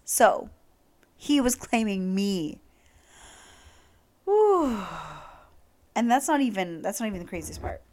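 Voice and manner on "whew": high loud whew. Real, not acted.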